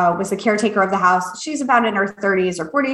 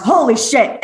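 shocked. Retold or imitated. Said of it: "and I went".

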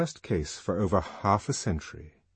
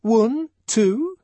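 high, loud, scornful guesses; contemptuous; 8 dB louder than usual. A narrator's reading. Fanny guessing how few men, contemptuous. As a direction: fling out each number as a scoff. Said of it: "shrugging her shoulders contemptuously".

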